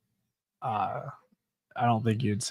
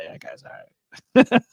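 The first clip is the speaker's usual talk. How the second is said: laughing imitation; 11 semitones higher, 5 dB louder, much slower than usual. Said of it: "And he's like".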